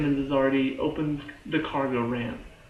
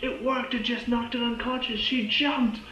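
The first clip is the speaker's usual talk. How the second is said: urgent report; 9 semitones higher than usual. Retold or imitated. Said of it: "he's like".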